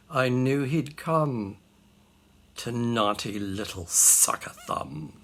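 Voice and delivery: low, slow, knowing mother; hushed, ominous told-you-so; knowing disapproval. Acted. Mamma's grim verdict in verse.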